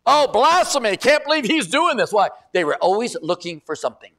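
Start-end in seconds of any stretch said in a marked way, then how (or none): 0.1-2.1 high, loud mock outrage; scandalised, raised and indignant; outraged Pharisees. Pastor voicing the Pharisees condemning Jesus, loud outrage. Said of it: "They're like".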